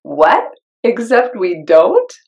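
incredulous echo with a rising question; surprised disbelief. Real, not acted. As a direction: repeat it back like you can't believe it. Reacting in disbelief to text.

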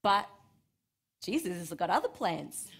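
ordinary talk, nothing marked

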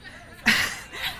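laugh. Real, not acted. Preacher laughs at fooled audience.

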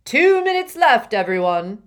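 loud call across the room. A narrator's reading. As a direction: call out to the whole class. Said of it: "just as Slughorn called".

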